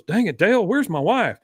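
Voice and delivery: mildly fed up husband; lightly voiced Hank. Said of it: "He goes".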